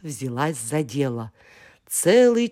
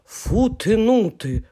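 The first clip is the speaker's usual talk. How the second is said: snort; 6 semitones higher than usual. Acted. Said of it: "фыркнул Ф".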